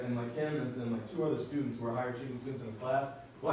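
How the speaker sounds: ordinary talk, nothing marked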